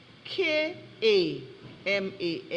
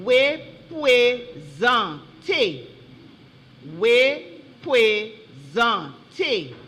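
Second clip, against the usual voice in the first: loud, drawn out syllable by syllable; spelling bee pronouncer, each syllable set apart; slow word dictation; 5 dB louder, much slower than usual. Real, not acted. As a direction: call out the contest word very slowly and clearly.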